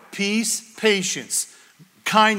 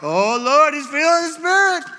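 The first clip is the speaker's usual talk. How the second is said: high, loud mock fervour rising higher; excited prayer cry; 8 semitones higher, 5 dB louder than usual. Ladies praying over a shaking boy, hammed-up excited cry. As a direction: whoop it up like a carried-away prayer group. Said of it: "and they're like".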